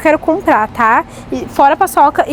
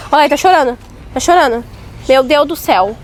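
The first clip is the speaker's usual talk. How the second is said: ordinary delivery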